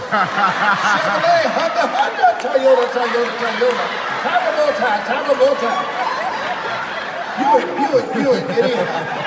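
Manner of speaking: loud laughing chant of car names; hammed-up ecstatic babble; mock speaking in tongues. Mock tongues, crowd roaring.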